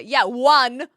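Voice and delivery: loud scoffing "one"; scoffing retort. Real, not acted. Streamer scoffing loudly at chat's objection.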